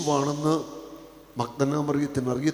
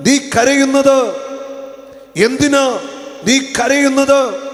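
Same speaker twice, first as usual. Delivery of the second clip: impassioned preaching; 10 semitones higher, 11 dB louder than usual. Acted. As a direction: preach it with full force to the crowd. Preaching at full force. (ആവേശത്തോടെയുള്ള പ്രസംഗം)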